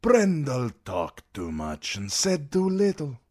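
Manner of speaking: sellsword boasting to the queen; flamboyant sellsword. A narrator's reading. Daario voice.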